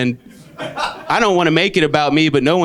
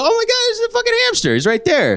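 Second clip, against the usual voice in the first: much higher, fast mock shock; high, fast yelp of surprise at the ornaments; shocked discovery; faster than usual. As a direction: blurt out the find in a high rush. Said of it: "you go".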